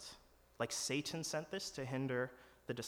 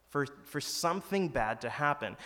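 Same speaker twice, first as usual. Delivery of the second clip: usual speaking voice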